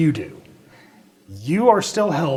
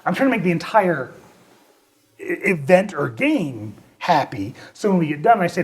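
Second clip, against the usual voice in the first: ordinary talk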